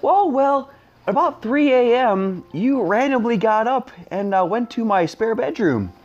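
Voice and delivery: imitated girlfriend. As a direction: lift your voice to mimic her.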